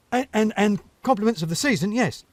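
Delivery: flustered hedgehog, tripping over words; nervous stammer. Acted. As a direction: stumble on the repeated and. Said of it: "stammered Urchin".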